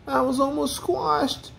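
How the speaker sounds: high-pitched storybook wail. A narrator's reading. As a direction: whimper it way up high. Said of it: "he wailed".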